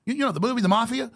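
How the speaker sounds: lightly voiced condescending mobster asking; mimicking a mobster. Mobster in Connecticut quizzing him, mildly mock-patronizing. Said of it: "he goes".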